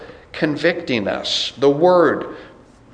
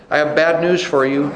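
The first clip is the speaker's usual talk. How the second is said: ordinary talk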